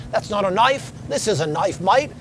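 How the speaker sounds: cheeky Australian movie quote, mate pushed up high; Crocodile Dundee. Retold or imitated. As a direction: quote the famous line in a playful Australian accent. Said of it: "Mick Dundee from Crocodile Dundee".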